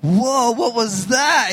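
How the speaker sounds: drawn-out startled whoa on waking; bewildered waking. Retold or imitated. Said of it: "woke up and I was like".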